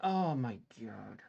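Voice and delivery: low, soft, defeated murmur; crushed, quiet despair; despairing hush. Real, not acted. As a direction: barely get the words out. Despair after losing trade.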